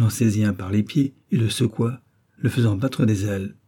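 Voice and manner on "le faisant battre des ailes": plain reading voice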